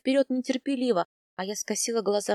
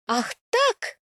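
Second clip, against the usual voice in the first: much higher, louder indignant cry; boiling over; 12 semitones higher, 4 dB louder than usual. A narrator's reading. Wife boiling over at her husband, high indignant cry. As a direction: explode with indignation. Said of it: "закипаю я".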